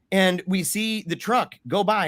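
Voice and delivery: normal talking voice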